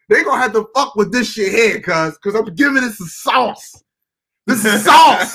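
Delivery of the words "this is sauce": laughing hype. Real, not acted. Hyping his own remix.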